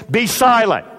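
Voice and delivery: sharp command to be silent. Acted. Jesus silencing the demon with a command. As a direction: bark it like a master silencing a demon. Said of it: "It's an imperative, a word of command".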